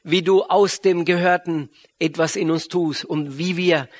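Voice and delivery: ordinary talk, nothing marked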